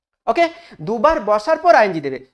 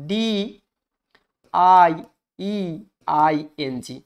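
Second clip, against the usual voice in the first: spelled out slowly with gaps; letter by letter; 4 semitones lower, slower than usual. Shown as a demonstration. Teacher warning students off a wrong spelling, spelled letter by letter. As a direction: separate each letter with a pause.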